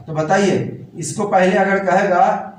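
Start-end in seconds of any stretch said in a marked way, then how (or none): none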